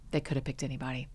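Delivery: softly murmured, fading to a whisper; hushed. Retold or imitated. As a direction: say it low, almost to yourself. Car ritual, hushed quote.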